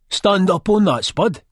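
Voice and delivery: loud, high command; barked order. A narrator's reading. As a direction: order your mate up there. Chizzy orders Spud about.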